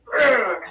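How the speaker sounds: short grinding throat growl. Shown as a demonstration. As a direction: grind the voice as a bad example. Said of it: "no grinding of your voice".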